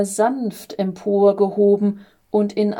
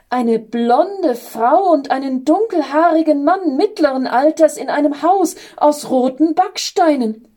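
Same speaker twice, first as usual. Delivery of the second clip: ordinary delivery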